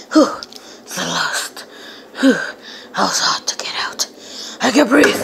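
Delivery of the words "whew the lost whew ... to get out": whispered, out of breath; a soft breathless whisper, much softer. Real, not acted. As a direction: gasp it out in a whisper after escaping. Breathless after escaping hiding spot.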